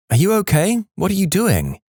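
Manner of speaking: high-pitched voice for a wife; high questioning wife voice. Acted. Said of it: "My wife's favorite story; She asked".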